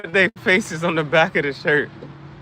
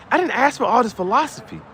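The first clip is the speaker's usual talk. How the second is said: normal talking voice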